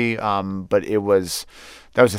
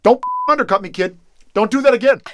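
mock scolding, much higher than usual; Barack Obama yelling; 12 semitones higher, 5 dB louder than usual. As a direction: imitate a politician telling off a kid. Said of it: "He did yell at my partner".

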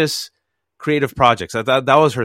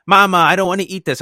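put-on higher, louder kid's whine; whiny child; 7 semitones higher, 5 dB louder than usual. Little boy whining to his mother, higher and louder. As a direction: complain like a little boy refusing dinner.

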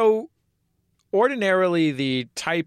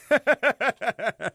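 high-pitched burst of laughter; quick, rattling high laugh; 10 semitones higher than usual. Real, not acted. Laughing at the hella joke.

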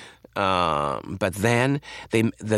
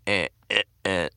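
halting speech; 3 semitones lower, 3 dB quieter than usual. Shown as a demonstration. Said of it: "halting way".

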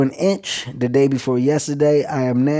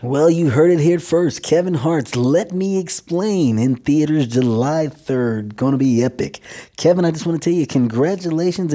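hammed-up radio announcer delivery, pitched up in swoops; 3 semitones higher than usual. Shown as a demonstration. Guest showing the host his radio voice impression, hammed-up announcer patter. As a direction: put on a typical radio DJ voice. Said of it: "this is my radio voice impression".

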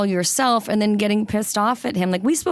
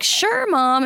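put-on happy kids; 8 semitones higher than usual. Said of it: "everybody would be like".

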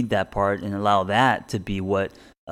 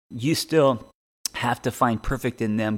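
ordinary talk, nothing marked

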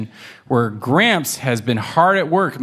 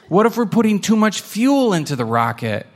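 thoughtful kitten voice; 8 semitones higher than usual. Acted. Pancake's business lunch idea.

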